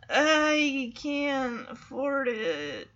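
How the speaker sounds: very slow, drawn-out words; mock reluctant admission. Boyfriend unable to admit the price, mock slow reluctance. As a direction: drag it out like a painful confession.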